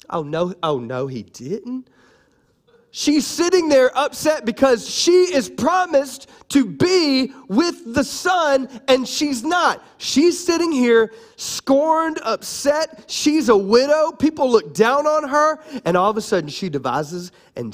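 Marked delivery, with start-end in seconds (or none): 0.1-1.9 slow, indignant oh-no; sassy disbelief. Retold or imitated. Preacher voicing Tamar seeing Judah pass, slow sassy disbelief. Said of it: "She goes". 3.0-15.6 high, emphatic preaching; worked-up indignation. Acted. Preacher explaining why Tamar is upset, high-pitched indignant emphasis.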